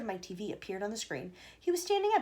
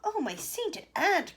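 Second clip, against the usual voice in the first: high, slow, drawn-out exclamation; dismayed cry; 9 semitones higher, 4 dB louder, much slower than usual. A narrator's reading. Wonka crying out in disgust at Mike. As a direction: stretch it out in horror. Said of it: "cried Mister Wonka".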